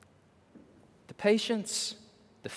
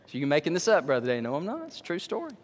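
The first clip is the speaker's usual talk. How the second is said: normal talking voice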